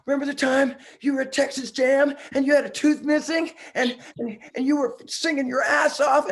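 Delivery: high, loud, breathless gushing; gushing fanboy. Retold or imitated. Starstruck fan gushing at his idol in the green room, high and breathless. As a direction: spill it all out like an overexcited fan. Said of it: "Calm down, calm down".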